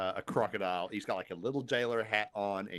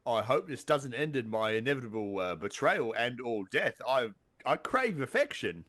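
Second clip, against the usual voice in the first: English crocodile jailer; 3 dB louder than usual. Pretend. Crocodile hoping the hug isn't betrayal, needy put-on English accent. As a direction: plead for affection as a comic English guard. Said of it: "he says".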